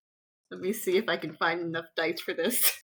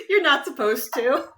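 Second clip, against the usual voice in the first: laughing; 4 semitones higher, 4 dB louder than usual. Real, not acted. Laughing player answers in excitement.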